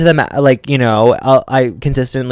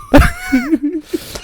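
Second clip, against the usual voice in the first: high-pitched drawn laugh; 5 dB quieter than usual. Real, not acted. Laughs at own confession.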